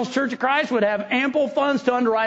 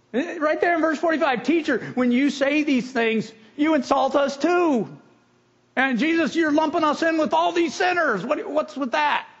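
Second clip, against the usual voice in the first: mock whiny complaint, higher pitch; whining Pharisees; 7 semitones higher than usual. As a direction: whine it like a wounded, offended Pharisee. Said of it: "the first thing they did was whine".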